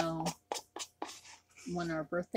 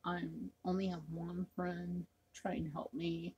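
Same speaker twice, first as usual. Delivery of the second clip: ordinary talk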